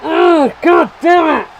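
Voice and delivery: loud exasperated shout at the screen; frustrated outburst. Real, not acted. Gamer yelling at his team's defending, furious shout. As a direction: blow up at the defending. Conceding at near post again.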